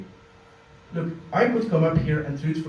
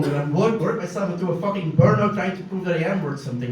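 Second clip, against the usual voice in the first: usual speaking voice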